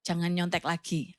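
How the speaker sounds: father comforts his daughter after the test; gentle father. Retold or imitated. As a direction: reassure your crying daughter on the phone. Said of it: "papanya Kak Novi bilang gini".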